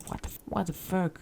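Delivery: a hint of mock English accent. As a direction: slip into an English accent, kept soft. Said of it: "je prends un accent anglais".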